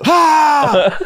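high, loud victory shout; triumphant yell. Retold or imitated. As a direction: yell it out to the whole crowd. Triumphant roar to the crowd.

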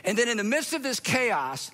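ordinary delivery

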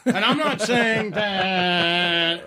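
said through real laughter, slow and drawn out; laughing. Real, not acted. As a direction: laugh your way through the denial. Laughing while protesting he isn't judging.

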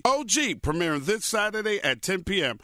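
smooth sponsor ad read. Acted. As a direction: read it like a host ad.

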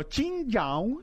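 slow, high mock-Chinese "Chingaon"; exaggerated pretend Chinese singsong; joke Chinese accent. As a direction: stretch out the fake Chinese tones. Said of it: "no, no es chingao".

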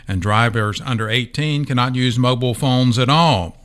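ordinary talk, nothing marked

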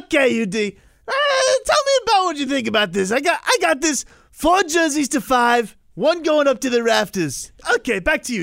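hyped reporter voice. Pretend. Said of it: "mocking his own reporter voice".

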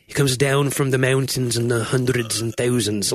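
put-on a light Scottish accent, rolling the words; a lightly done Scottish accent. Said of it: "in my Scottish".

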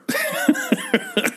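high burst of laughter; laughing. Real, not acted. Co-host amused by the ultimatum.

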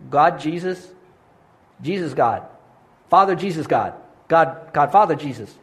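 halting, nervous prayer with long pauses; shaky voice. Retold or imitated. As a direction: stammer out a first prayer, scared and unsure. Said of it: "with a shaky voice".

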